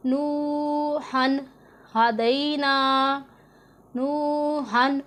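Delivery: very slow, drawn-out recitation of नूहन with a clear नून; Quranic recitation. Shown as a demonstration. Teacher reciting the tanween example slowly for her students. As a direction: stretch नूहन out, sounding the नून plainly. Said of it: "इसी तरह पढ़ना है".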